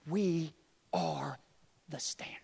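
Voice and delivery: dropping to a whisper; a whispered proclamation; hushed whisper. Acted. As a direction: whisper it slowly for weight. Dramatic hushed line.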